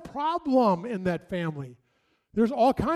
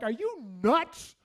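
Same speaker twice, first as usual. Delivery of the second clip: incredulous parent; 5 semitones higher, slower than usual. Retold or imitated.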